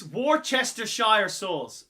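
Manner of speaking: slow Worcestershire as "war-chester-shire"; loud, slow WAR-CHESS-TER-SHIRE for Worcestershire; spelt-out pronunciation. Shown as a demonstration. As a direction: sound out every syllable of Worcestershire, "shire" like "fire". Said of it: "[name]'s got it right".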